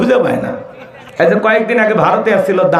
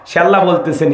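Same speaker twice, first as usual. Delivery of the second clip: usual speaking voice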